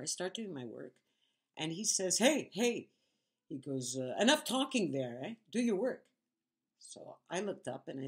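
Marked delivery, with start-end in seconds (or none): 2.2-2.9 high, loud "hey" to get attention; retold sharp, raised call; bossy call-out. Retells coworker ordering her to work. 4.2-6.0 loud, high mock order; retold bossy command, voice raised; bossy scolding. Retells coworker ordering her to work.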